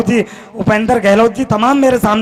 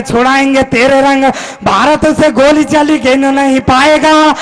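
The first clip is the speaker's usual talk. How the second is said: chant-like patriotic verse, building in intensity; rousing stage poet; poem recitation; 4 semitones higher than usual. Acted. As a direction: declaim the verse in driving rhythm, held high. Recites his Kashmir poem.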